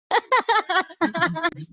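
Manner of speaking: real high-pitched laugh; laughter. Real, not acted. Teacher joking with his class about Game of Thrones, laughing. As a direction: burst into a long, high laugh.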